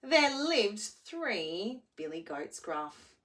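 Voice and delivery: animated storybook narration; reading to children. A narrator's reading. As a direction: tell it like a bedtime story.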